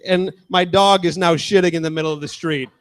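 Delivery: usual speaking voice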